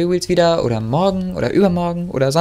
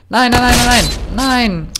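desperate no; 8 semitones higher, 4 dB louder than usual. Real, not acted. Figur stirbt.

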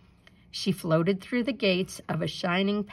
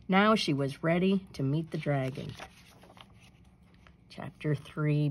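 usual speaking voice

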